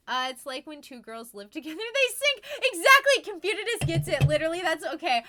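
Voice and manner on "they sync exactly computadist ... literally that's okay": laughing excitedly. Real, not acted. Laughing at the joke.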